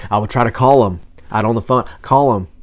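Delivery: pressing hard on the repeated call; desperate push on call 'em; urgent. Real, not acted. Desperate calling.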